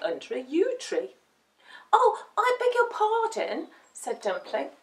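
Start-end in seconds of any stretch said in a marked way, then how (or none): 1.9-3.7 high little puppy voice; startled, polite young pup; apologetic puppy. A narrator's reading. Dumpling bumps into cat.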